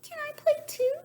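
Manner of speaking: very high sweet bird voice; a small bird asking to join; little bluebird. A narrator's reading. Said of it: "she asked".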